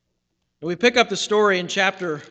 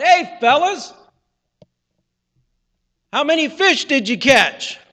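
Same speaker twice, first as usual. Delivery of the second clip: calling out from shore; 7 semitones higher, 6 dB louder than usual. Acted. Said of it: "a voice calls out".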